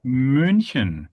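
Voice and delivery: München slowly, with a long rounded ü; German pronunciation. Shown as a demonstration. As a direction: model München in German, stretching the first syllable. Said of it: "In German, we say München".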